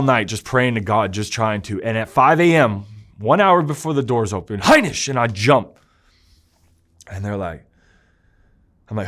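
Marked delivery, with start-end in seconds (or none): 4.6-5.1 a sharp, high call of the name; guard calling out. Retold or imitated. Guard waking the prisoner at five, high shouted call. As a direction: bark the surname down the hall.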